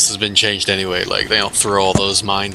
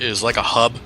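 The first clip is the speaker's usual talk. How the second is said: normal talking voice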